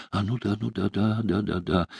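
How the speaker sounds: soft, hasty self-correction; hushed string of backtracking assent; catching himself. A narrator's reading. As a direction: agree quickly, as if just remembering yourself. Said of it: "как бы спохватился он".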